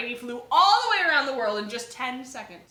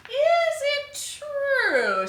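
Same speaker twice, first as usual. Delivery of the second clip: very slow, much higher coaxing question; sweet flattering ask; 7 dB louder, much slower than usual. Acted. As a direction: draw it out to flatter him. Elizabeth flatters the dragon.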